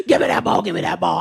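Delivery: put-on low grunting toddler shout. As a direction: bark it like a fierce little kid. Aggressive grandson demand.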